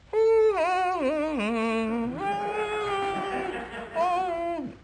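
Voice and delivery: long high shrieking monkey laugh. Acted. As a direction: cackle like a giddy monkey.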